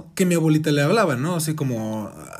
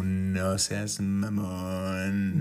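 low, very slow, soft disbelief; stunned mutter; 7 semitones lower, 7 dB quieter, much slower than usual. Retold or imitated. Mom reacting to the impossible visit, low and drawn out. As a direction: let it drop out low and slow. Mother's stunned reply, voiced.

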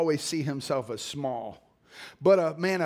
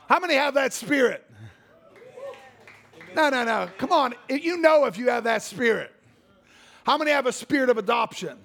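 higher and louder, laughing call-out; rousing the crowd; 8 semitones higher, 4 dB louder than usual. Real, not acted. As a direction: stir up the room. Rousing the congregation.

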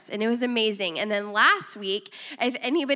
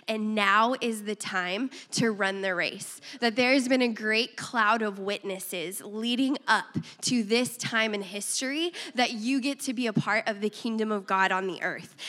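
ordinary talk, nothing marked

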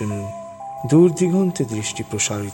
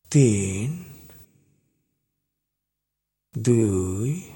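slow hypnotist voice; 2 semitones lower than usual. Acted. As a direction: count down slowly to put them under. Slow hypnotic countdown to zero.

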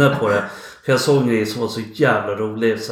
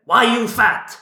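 much higher, laughing mock Japanese-accented English; 10 semitones higher, slower than usual. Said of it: "Första han säger är". (skrattande imitation med japansk brytning)

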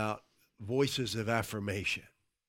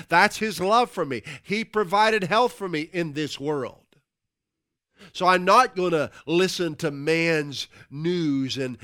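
loud, raised preacher's voice; preaching; 4 semitones higher, 9 dB louder than usual. Real, not acted. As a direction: declare it boldly and emphatically to the church. Preaches at full force.

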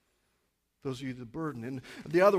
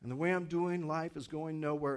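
normal talking voice

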